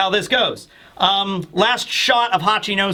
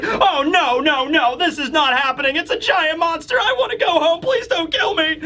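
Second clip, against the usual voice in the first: high, frantic whimpering plea; much higher panicky squeal; panicked freak-out; 10 semitones higher than usual. Retold or imitated. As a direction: beg like you're about to be eaten. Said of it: "Caribou's freaking out".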